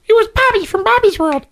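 very high cartoon kid voice; Bobby cartoon voice. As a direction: sound like a cartoon little boy. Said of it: "Howie Mandel used to have a show called Bobby's World".

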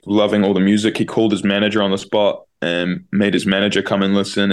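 ordinary talk

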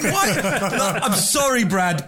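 ordinary delivery

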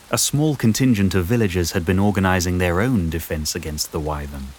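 ordinary delivery

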